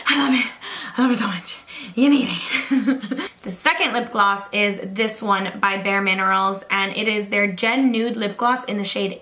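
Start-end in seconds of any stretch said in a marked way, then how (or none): 0.1-3.3 imitating herself panting between gushes; mock out of breath. Vlogger mocking her own winded product raving, playfully out of breath. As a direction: puff out short phrases, then break into a laugh. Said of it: "I'm literally out of breath".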